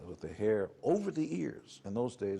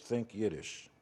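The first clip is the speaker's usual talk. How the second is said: ordinary talk, nothing marked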